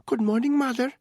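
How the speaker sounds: put-on a timid boy greeting his mother, high and small. A narrator's reading. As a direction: pipe it up like a young boy.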